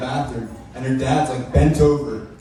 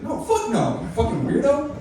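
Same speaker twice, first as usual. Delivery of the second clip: put-on girlfriend voice; 12 semitones higher than usual. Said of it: "she's like".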